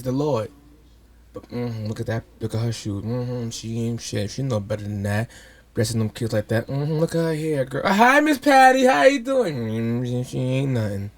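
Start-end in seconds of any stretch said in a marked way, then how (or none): 1.3-7.9 low, quiet judgmental mutter; side-eye gossip under your breath; gossiping church lady. Retold or imitated. Imitating gossiping church ladies. 7.9-9.6 high, loud sugary hello; fake-friendly church lady voice; fake sweet greeting. 9.6-11.1 gossiping church lady. Retold or imitated. Gossip resumes after greeting.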